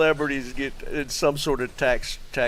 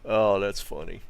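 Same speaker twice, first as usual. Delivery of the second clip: ordinary delivery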